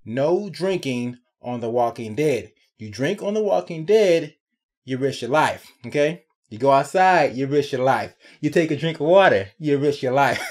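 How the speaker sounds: hammed-up safety warning; mock public service announcement. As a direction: lecture the viewers like a PSA. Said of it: "a public service announcement".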